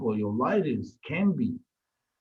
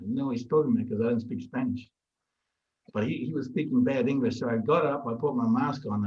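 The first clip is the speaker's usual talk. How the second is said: normal talking voice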